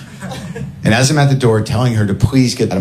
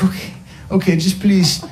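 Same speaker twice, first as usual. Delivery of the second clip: pleading stranger; 11 semitones higher than usual. Retold or imitated. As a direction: plead for kindness. Neighbour pleading.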